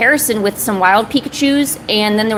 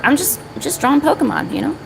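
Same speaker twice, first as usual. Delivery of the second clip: ordinary talk, nothing marked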